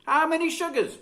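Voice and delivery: high, cheerful question; a motherly offer of tea; kind lady. A narrator's reading. Mrs Cole offers tea.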